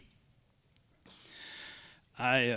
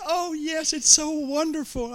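put-on much higher, slow, delighted child; joyful little girl; 14 semitones higher, 3 dB louder, slower than usual. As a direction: sing it out like a happy child. Girl's joy voiced high.

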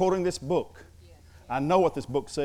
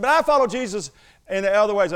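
high, loud imitation of a student objecting; mock an earnest student's protest; raised-pitch, insistent student voice; 6 semitones higher, 7 dB louder than usual. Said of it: "one student, he's nineteen years old".

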